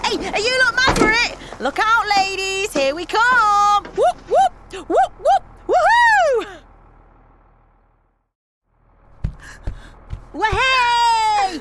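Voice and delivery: loud high whoops and cheers; party whooping. Acted. Mate hyping the mums onto the party bus, loud whooping.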